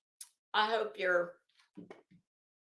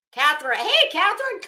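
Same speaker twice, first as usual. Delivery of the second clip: loud, high, thrilled hello; delighted greeting; 6 semitones higher, 9 dB louder than usual. Real, not acted. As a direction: call out to a long-lost friend. Greeting an old friend in chat.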